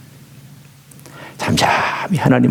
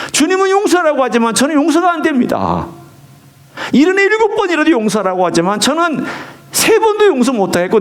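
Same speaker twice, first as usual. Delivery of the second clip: pouring out resentment to God, pitched higher; honest prayer; 7 semitones higher than usual. Shown as a demonstration. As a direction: pray it raw and honest, climbing higher. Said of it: "하나님 아버지 앞에 내 안에서 미워했던 감정 다 토해내세요". (미운 감정을 하나님께 토해내는 솔직하고 높은 기도)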